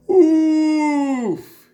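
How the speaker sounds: loud high winded oof; knocked breathless by a sudden blast; sudden loud gasp of shock. A narrator's reading. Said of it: "he gasped".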